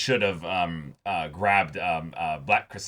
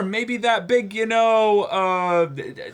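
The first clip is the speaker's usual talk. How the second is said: mock excited musing; 8 semitones higher, 4 dB louder, slower than usual. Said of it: "I'm like".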